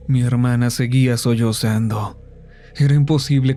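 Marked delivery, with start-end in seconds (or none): none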